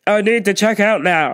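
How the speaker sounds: loud, demanding customer call; imitating the pushy shopper demanding service, loud; entitled customer. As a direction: snap for attention like you're owed it. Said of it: "She's like".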